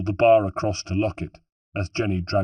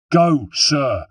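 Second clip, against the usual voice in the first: urgent, raised voice; insistent; 6 semitones higher, 6 dB louder than usual. A narrator's reading. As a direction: insist firmly, higher and louder. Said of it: "insisted Holden".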